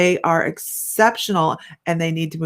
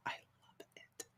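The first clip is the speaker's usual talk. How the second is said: soft dreamy whisper about the scent; 29 dB quieter than usual. Real, not acted. Host whispering her love of Palo Santo to viewers, hushed. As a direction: breathe it out like a secret.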